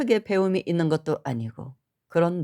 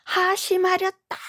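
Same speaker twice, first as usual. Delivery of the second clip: much higher, commanding monk's cry; stern shout; 12 semitones higher than usual. Retold or imitated. Monk striking the moktak, commanding shout. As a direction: bellow the command like a temple master. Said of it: "그 정도로 크게 목탁채를".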